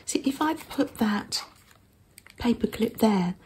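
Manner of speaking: ordinary talk, nothing marked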